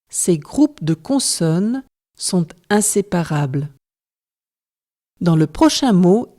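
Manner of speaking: ordinary delivery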